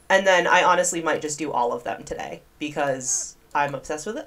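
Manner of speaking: ordinary talk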